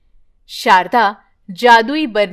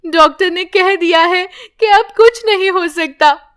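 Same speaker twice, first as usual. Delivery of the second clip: higher, tearful plea, louder; weeping confession; crying; 6 semitones higher, 4 dB louder than usual. Acted. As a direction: sob the apology to mother-in-law. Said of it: "Padma रोने लगती है".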